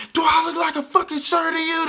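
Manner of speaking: high, loud mocking yell of an offended instructor; angry drill instructor. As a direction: bark it back like an insulted drill sergeant. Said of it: "They're just yelling at you".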